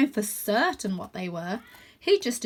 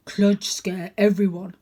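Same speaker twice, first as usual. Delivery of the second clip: slow, louder serious dog; deep voice; 5 dB louder, slower than usual. A narrator's reading. Dog declaring he can scare anyone, slow. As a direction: growl it out with menace. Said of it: "in a deep voice".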